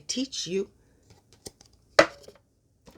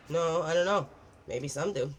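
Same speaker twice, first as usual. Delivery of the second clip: ordinary talk, nothing marked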